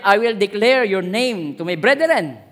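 slow, solemn reading of a psalm; scripture reading. Acted. Preacher reading a psalm to the congregation, solemn. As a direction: read the verse as a prayer. Reads verse slowly.

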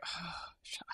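breathy whisper, no voice. Retold or imitated. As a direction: mutter it under your breath. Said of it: "and I was like".

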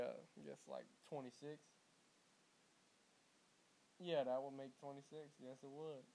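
usual speaking voice

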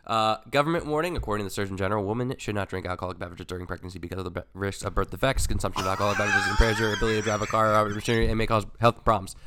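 rapid, loud legal warning read; fast disclaimer read. Acted. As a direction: rattle it off like a label warning. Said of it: "you're getting faster at that".

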